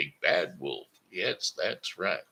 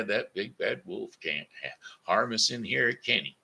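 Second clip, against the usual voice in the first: plain reading voice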